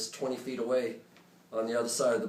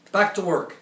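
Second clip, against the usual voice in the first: higher, loud barked command; shout; 8 semitones higher, 8 dB louder than usual. Retold or imitated. Captain shouting to the whole bridge crew. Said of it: "the captain shouts out to everyone".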